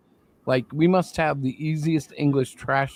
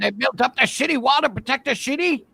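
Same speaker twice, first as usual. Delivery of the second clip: high, exaggerated mock Chinese accent; South Park Chinese accent; 11 semitones higher, 5 dB louder than usual. Recalling South Park's wall episode in a mock Chinese accent. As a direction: push up into a cartoon Chinese accent. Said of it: "best South Park, uh, accent was the".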